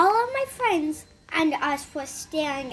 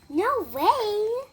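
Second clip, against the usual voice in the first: imitating a sassy classmate, higher swooping pitch; mock-sassy refusal in a higher voice; sassy retort; 5 semitones higher than usual. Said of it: "Being sassy at, at, at all of us".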